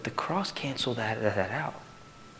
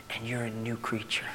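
whisper; 8 dB quieter than usual. Real, not acted. Soft closing point.

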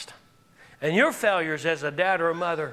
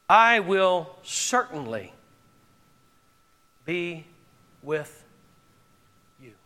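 gentle assurance; 4 semitones lower, slower than usual. Acted. Voicing God's assurance to Moses.

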